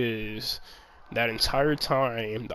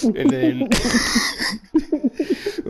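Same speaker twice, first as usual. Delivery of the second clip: laughing; 14 semitones higher, 6 dB louder, much slower than usual. Real, not acted.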